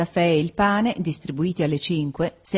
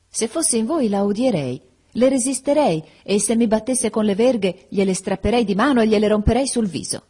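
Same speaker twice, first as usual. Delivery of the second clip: heated indignation; 5 semitones higher, 3 dB louder than usual. A narrator's reading. Indignant girl defending her friend.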